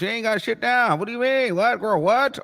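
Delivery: high incredulous questions; disbelieving. Real, not acted. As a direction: pitch up in mock outrage. Incredulous girl what.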